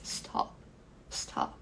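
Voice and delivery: stop, one short syllable. Shown as a demonstration. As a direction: stop said in one single beat. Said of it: "isso aqui eu falo em uma vez".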